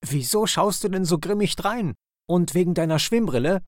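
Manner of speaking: cheeky chirpy questions, rushing on; grinning kingfisher. Acted. Kingfisher teases the grumpy otter, high and quick. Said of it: "rief der Eisvogel grinsend".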